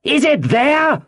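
high, demanding yell; roared question. A narrator's reading. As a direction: roar it up to the window at high pitch. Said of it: "roared Pew".

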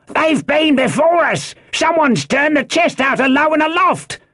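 high, alarmed shout from above; high-pitched urgent holler; crying out news. A narrator's reading. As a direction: yell the report down from a window. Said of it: "he cried".

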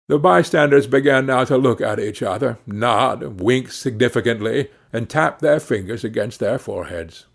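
ordinary delivery

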